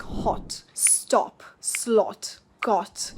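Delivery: HOT, STOP, SLOT, GOT with rounded British O; short rounded O. Shown as a demonstration. Teacher modelling British vowels, short rounded O. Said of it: "the short O. A rounder sound".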